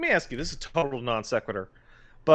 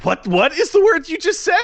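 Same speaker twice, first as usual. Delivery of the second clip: much higher, louder incredulous yelp; aghast at the word; shocked disbelief; 14 semitones higher, 7 dB louder than usual. Real, not acted. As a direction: squeal in disbelief. Shocked outburst at pronunciation.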